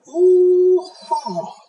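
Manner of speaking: high, loud attacking war whoop; long rising whoop into a sharp strike; battle cry. Acted. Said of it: "they attacked the wolf".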